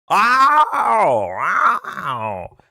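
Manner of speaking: angry cat sound. Retold or imitated. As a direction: yowl like a hurting cat, then fade. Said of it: "we have to work on the angry cat sound".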